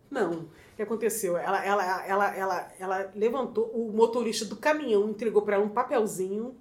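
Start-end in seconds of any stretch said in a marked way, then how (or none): none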